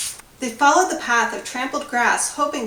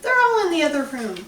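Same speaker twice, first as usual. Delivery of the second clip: put-on old lady ghost; 6 semitones higher, 4 dB louder than usual. A narrator's reading.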